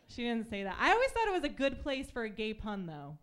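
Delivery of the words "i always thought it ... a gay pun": normal talking voice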